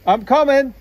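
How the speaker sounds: loud call from another room; calling from afar. Real, not acted. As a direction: shout back from far off. Partner shouts back from afar.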